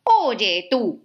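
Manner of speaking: grumpy little ladybug challenging; grouchy ladybug. Acted. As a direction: pick a fight like a cranky bug. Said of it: "le dijo la mariquita malhumorada".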